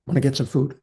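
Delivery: quiet, gentle invitation; soft, casual check-in with a tired wife; soft offer. Retold or imitated. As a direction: coax her softly toward food. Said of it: "like I said".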